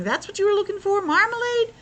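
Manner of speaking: high incredulous foreman voice; surprised foreman. Retold or imitated. Foreman asks the bear about marmalade, high disbelief. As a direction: ask it as if you can't believe it. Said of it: "he's saying".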